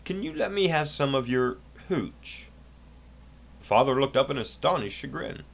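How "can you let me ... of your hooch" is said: a son's higher, careful request; careful asking. A narrator's reading. Son carefully asks his father for hooch, lightly voiced higher. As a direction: ask cautiously, testing the water. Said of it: "he began carefully".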